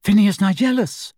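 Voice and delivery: high summons by name; calling out. A narrator's reading. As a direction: call for someone who hasn't answered. Calling the portrait again.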